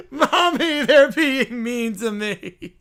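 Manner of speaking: put-on loud, drawn-out child's wail; mock bawling. Teased bear tattling to mommy, whiny bawling.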